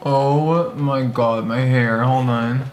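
lips held by wet mask; talking in a sheet mask. Real, not acted. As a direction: face covered, mouth hole open. Seen 0: Pink-haired speaker wears a wet sheet mask across the face throughout.

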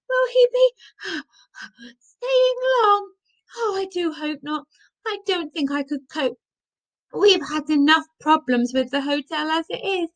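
high-pitched panting between phrases; out of breath. A narrator's reading. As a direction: gasp it out after being chased. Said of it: "He was too busy panting".

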